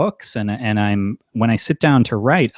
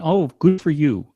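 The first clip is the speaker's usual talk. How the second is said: higher, mock-impressed put-down; condescending; 6 semitones higher than usual. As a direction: talk down with false praise. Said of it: "the condescending discussion".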